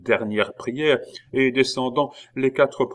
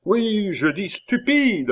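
higher, slow, stressed; indignant; 11 semitones higher, 3 dB louder, slower than usual. A narrator's reading. Abbé insisting with indignation, arms crossed. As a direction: repeat it with contempt. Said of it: "ajouta-t-il ... avec indignation".

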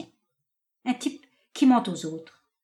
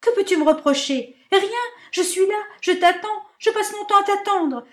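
high, loud, shrill-pitched rant; furious tirade; 8 semitones higher, 6 dB louder than usual. Acted. Marinette raging at her indifferent lover, loud high tirade. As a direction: hurl every grievance at him.

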